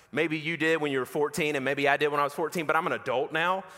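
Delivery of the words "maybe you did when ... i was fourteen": normal talking voice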